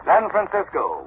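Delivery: normal talking voice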